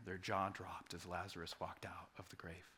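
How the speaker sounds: hushed, breathless whisper; whispered in awe of the miracle; awed whisper. Acted. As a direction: whisper it like a secret wonder. Awed storytelling moment.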